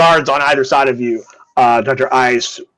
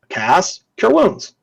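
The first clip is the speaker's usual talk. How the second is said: usual speaking voice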